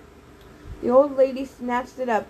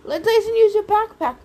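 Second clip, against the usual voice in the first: higher, louder urgent call; yelling; 9 semitones higher, 5 dB louder than usual. A narrator's reading. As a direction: shout over the chaos. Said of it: "I yelled".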